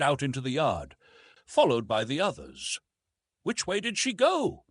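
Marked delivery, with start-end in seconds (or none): 3.4-4.7 anxious call, pitched well up; raised high cry. A narrator's reading. Clayton crying out to the household, pitched high.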